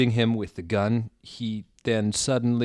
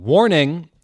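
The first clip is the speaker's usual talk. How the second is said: high, loud shouted warning; all-caps warning; 7 semitones higher, 12 dB louder than usual. Shown as a demonstration. Reading the fic's all-caps chapter warning aloud. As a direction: bark it like a caps-lock warning. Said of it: "All capitals warning".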